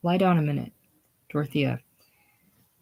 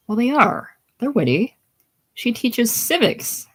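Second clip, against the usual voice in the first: higher-pitched defensive insistence; insistent protest; 7 semitones higher than usual. Acted. Bodhi defending Dorothea to Buddy on the phone, insistent.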